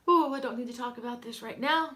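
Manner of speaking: welling up, pushing the words out; real choked-up upset. Real, not acted. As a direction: fight back grief and brush it off. Upset after mentioning uncle's death.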